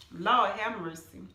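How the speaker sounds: ordinary talk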